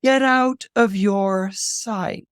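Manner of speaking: slow, drawn-out goddess command; ominous tone. Acted. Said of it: "from that tone it's not good".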